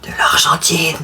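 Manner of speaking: soft whispered confession; timid admission. Acted. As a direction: own up under your breath. Afraid to admit the shirt. (aveu craintif à voix chuchotée)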